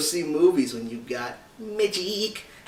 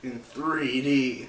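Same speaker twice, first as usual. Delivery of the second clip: dramatic announcer; 2 semitones lower, 4 dB quieter, much slower than usual. Acted.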